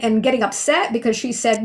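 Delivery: ordinary talk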